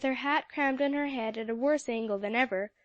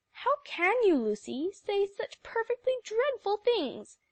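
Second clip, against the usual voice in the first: higher, shocked reproach; indignant cry; 8 semitones higher than usual. A narrator's reading. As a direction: scold a friend for cruel words. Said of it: "cried Polly".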